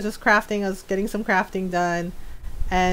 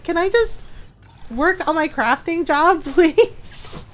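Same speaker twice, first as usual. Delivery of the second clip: whiny plea; 8 semitones higher, slower than usual. Retold or imitated. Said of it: "I was just like".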